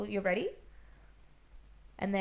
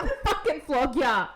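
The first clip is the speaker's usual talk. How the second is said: mock threat; 5 semitones higher, 5 dB louder, slower than usual. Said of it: "Say it again".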